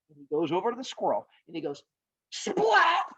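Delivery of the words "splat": high, punched splat noise; cartoon impact. Rhinoceros hits the squirrel with a rock, cartoonish impact. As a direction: voice a comic smack with force.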